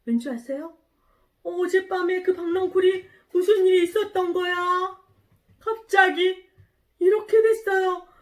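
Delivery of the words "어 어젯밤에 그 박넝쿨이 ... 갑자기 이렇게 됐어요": high, loud bewildered outcry; acted storybook astonishment; shocked surprise. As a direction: exclaim in dismay at a sudden loss. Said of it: "이렇게 유나가 깜짝 놀랐어요".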